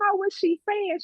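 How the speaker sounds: high, puzzled man's voice asking again; baffled shop owner. Retold or imitated. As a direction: owner asking her friend to translate. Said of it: "He said".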